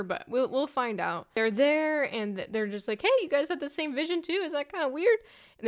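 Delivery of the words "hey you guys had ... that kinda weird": much higher, faster mock-excited question; hammed-up high eager question; higher excited retell. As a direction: repeat it pitched up and quick. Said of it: "same words retold".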